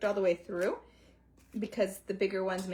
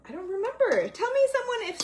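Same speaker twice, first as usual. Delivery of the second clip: laughing; 13 semitones higher, 5 dB louder than usual. Real, not acted. Laughing at own forgetfulness.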